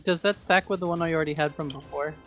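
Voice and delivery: ordinary delivery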